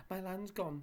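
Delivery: high loud panicked shriek. A narrator's reading. Saucepan man shrieks finding his land gone. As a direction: wail at the lost land. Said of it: "shrieked the saucepan man".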